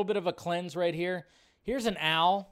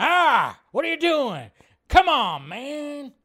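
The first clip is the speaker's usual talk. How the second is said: much higher, louder mock scolding grandpa; grumpy grandpa; 10 semitones higher, 8 dB louder, slower than usual. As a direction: play the cranky grandpa telling you off. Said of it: "sounds like your grandpa who gets all pissed off at you".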